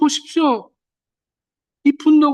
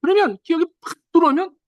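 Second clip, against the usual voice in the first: usual speaking voice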